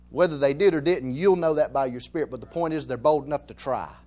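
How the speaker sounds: usual speaking voice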